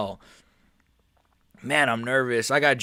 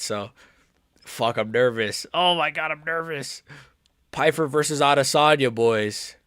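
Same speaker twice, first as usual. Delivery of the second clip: ordinary delivery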